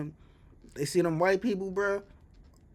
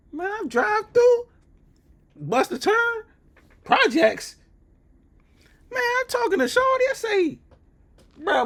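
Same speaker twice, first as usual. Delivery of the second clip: loud, squealing disbelief far above usual voice; high-pitched outrage; 5 dB louder than usual. Retold or imitated. Retelling his drive into the projects, loud high-pitched outrage. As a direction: act out the shock, voice shooting up.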